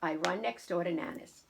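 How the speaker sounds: usual speaking voice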